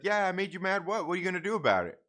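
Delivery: mock-tough challenge. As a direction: egg the neighbor on, daring him. Retold confrontation with angry neighbor.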